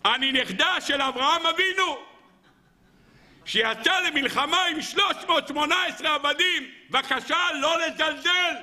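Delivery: high, put-on old lady voice, proud and offended; indignant old grandmother. Grandmother scolding Rav Nachman for ignoring her, high indignant old voice. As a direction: puff up as Abraham's granddaughter, pitched high. Grandmother demands respect from rabbi.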